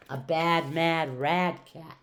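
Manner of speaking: ordinary delivery, nothing marked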